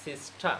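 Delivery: সিস্টা ending on open "aa", no R; open-ended সিস্টা; silent final R. Shown as a demonstration. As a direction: drop the R at the end of সিস্টা. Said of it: "কী বলতে হবে".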